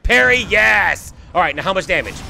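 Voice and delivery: ordinary delivery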